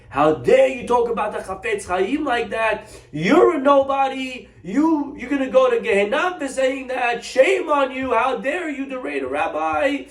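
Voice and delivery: high, loud furious scolding; retold man yelling in indignant fury; angry yelling. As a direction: tear into him with outrage. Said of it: "He starts yelling at this".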